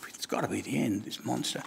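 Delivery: hopeless whisper; whispered thought. Acted. Cup thinking to itself after the kiln, a defeated whisper. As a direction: breathe it out as a private despairing thought. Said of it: "I'm thinking".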